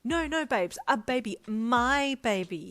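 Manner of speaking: high, loud, emphatic insisting; insistent correction. A narrator's reading. Friend insists the puppy is his baby, emphatic. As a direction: correct them, stretching out the last words. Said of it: "he corrected".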